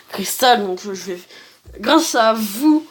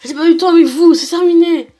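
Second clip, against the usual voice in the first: higher, forceful declaration; acted battle threat; 5 semitones higher, 5 dB louder than usual. Player reads out the fighter's threat, acted battle threat. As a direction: threaten them in character.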